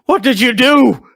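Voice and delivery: very high, very loud repeated demand; panicked shriek. Acted. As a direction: scream the question in horror. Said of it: "Debria's just like".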